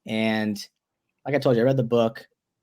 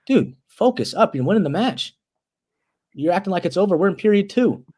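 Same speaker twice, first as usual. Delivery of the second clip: higher-pitched, insistent reproach; urgent coach's scolding; 6 semitones higher than usual. Retold or imitated. Coach telling wrestler he is winning the match, urgent scolding. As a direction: imitate a coach snapping someone into focus.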